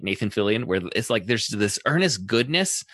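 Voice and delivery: normal talking voice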